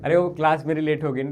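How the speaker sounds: ordinary talk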